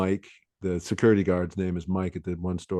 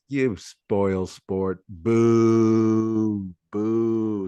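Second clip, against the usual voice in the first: mock taunting; 3 dB louder, much slower than usual. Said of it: "I'm always like".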